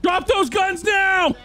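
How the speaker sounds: shouting commands. Acted. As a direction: bark orders at the crowd. Mall cop yelling at crowd.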